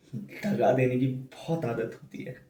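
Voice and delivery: normal talking voice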